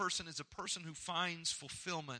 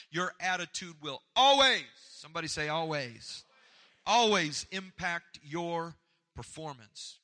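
preaching; 2 semitones higher, 8 dB louder than usual. Real, not acted. Preacher rising to full force.

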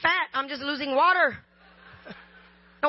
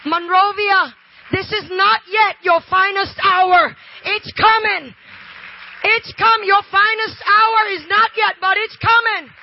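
loud preacher's proclamation; rally the congregation at full force; loud, emphatic sermon promise; impassioned preaching; 5 semitones higher, 10 dB louder, slower than usual. Real, not acted.

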